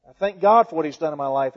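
ordinary talk, nothing marked

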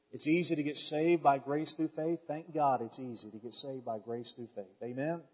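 usual speaking voice

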